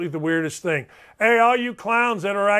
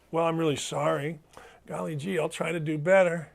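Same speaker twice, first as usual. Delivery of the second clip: mock meek apology; 2 semitones lower, 6 dB quieter than usual. Host imagining the apology he won't give a heckler, mock meek. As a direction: apologize sheepishly, plainly insincere.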